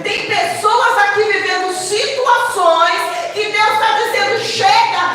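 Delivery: loud, rising sermon climax; preacher crying out to the church, loud and rising; fiery preaching. Real, not acted. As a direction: preach with full force to the church. Full-force preaching to congregation.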